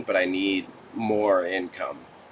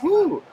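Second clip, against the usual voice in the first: high whooping yelp at the heat; real whoo of exasperation at the heat; 4 dB louder than usual. Real, not acted. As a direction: let out a loud high whoo. Sweating under studio lights.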